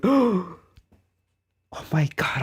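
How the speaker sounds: reliving the shock of the ban. Retold or imitated. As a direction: gasp it out. Shocked at being banned.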